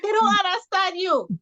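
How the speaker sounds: high-pitched mock scolding; retold exasperated warning to a husband. Wife telling husband about drive-thru staff, exasperated.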